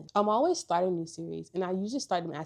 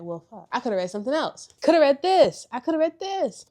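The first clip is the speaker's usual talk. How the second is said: frustrated realisation; 7 semitones higher, 11 dB louder than usual. Retold or imitated. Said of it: "I was like".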